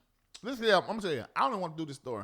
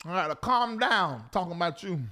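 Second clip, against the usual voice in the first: usual speaking voice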